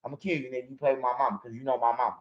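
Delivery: low, soft menacing warning; a hushed scare-tactic threat to a robbery target; low quiet threat. Shown as a demonstration. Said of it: "a scare tactic".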